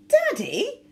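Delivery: very high, loud shriek of the word; a daughter scandalised, shrieking; girl shrieking at her father. A narrator's reading. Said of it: "shrieked Daisy".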